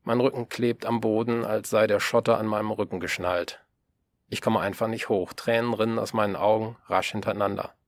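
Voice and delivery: usual speaking voice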